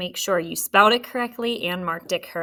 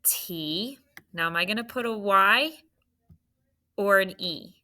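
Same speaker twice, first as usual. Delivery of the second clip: normal talking voice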